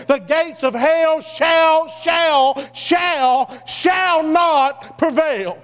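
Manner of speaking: each shall stretched slowly, building to shall not prevail; drawn-out shall. Acted. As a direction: hold every shall long, then land shall not prevail slowly. Preacher draws out scripture quote.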